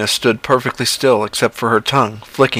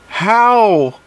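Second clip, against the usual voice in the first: much higher begging How?; John begging; 11 semitones higher than usual. Acted. John begs the creature to tell him how, high. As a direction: plead for the answer. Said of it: "he begged to know".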